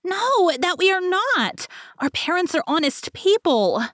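high, shrill-pitched angry chick voices; angry screaming little birds. A narrator's reading. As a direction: scream it as outraged baby wrens. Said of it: "they were frightfully angry and screamed".

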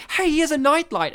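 loud, high riffing call-out; playful retold movie riffing; excited heckle. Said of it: "And I would often go".

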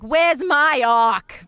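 loud, high, drawn-out whine; slow mock outcry from an upset mobster; upset Christopher. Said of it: "he's very upset".

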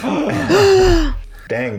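loud, high burst of surprise; excited shout. Real, not acted. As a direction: blurt it out at the pick. Reacting to the book pick.

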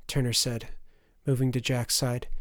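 plain reading voice

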